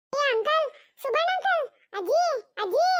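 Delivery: put-on high child's voice calling uncle and grandma; little boy shouting for the grown-ups. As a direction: call out like a small boy running up. Child Chintu runs up calling.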